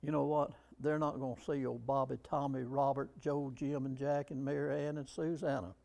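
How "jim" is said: ordinary talk, nothing marked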